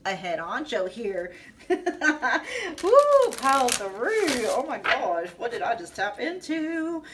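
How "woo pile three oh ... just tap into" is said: much higher, laughing whoop then slow awe; real giddy excitement; excited laughing whoop. Real, not acted. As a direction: laugh it out, thrilled and amazed. Overwhelmed by the reading.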